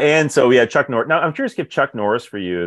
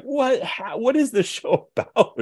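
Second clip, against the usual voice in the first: bewildered viewer; slower than usual. Retold or imitated.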